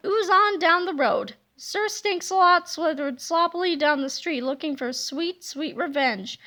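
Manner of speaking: plain reading voice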